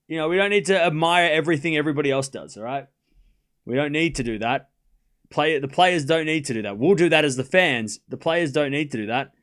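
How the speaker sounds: ordinary talk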